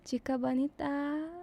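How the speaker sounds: soft breathy whisper, swooning. Retold or imitated. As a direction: whisper it close like a sweet nothing in the ear. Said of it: "I'm like".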